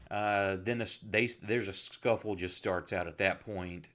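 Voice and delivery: ordinary delivery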